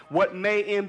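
ordinary talk, nothing marked

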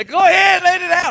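high, loud mock shout. Church ladies urging on a crying girl at the altar, shouting. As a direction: cheer them on at a high-pitched yell. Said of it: "she gets louder".

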